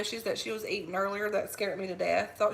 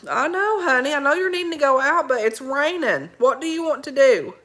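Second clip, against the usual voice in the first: loud coaxing; 4 semitones higher, 8 dB louder than usual. Real, not acted. Louder, higher talk over the dog.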